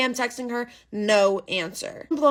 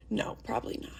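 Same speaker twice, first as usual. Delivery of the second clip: much softer unvoiced aside; whisper; 6 semitones lower, 10 dB quieter than usual. Real, not acted. Dismissing her own surprise party hope, whispered. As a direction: breathe it to yourself, deflated.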